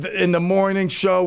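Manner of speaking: normal talking voice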